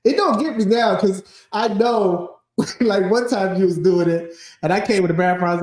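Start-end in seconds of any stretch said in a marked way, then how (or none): none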